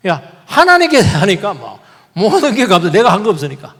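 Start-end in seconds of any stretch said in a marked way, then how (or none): none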